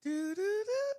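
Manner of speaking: high sung beeps; high mimicked phone beeps. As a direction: imitate a disconnected line tone. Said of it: "it would go".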